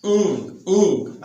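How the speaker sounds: ऊंग held slowly as a nasal ng hum; ng sound demo. Shown as a demonstration. Teacher to students, slowly demonstrating the ng sound ऊंग. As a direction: draw out ऊंग slowly from the back of the throat. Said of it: "N G".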